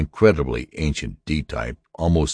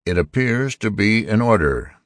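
high-pitched robot, grudgingly admitting; reluctant old robot; 6 semitones higher than usual. A narrator's reading. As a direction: concede it reluctantly as an ancient robot. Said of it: "the D admitted reluctantly".

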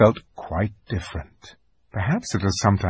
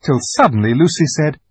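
ordinary delivery

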